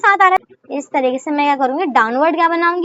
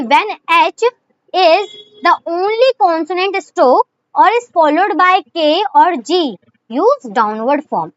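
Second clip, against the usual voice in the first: very slow, dictating a rule; teacher dictating a shorthand rule; slow rule reading; much slower than usual. Shown as a demonstration. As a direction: read the rule out word by word for copying. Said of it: "reads rule for students".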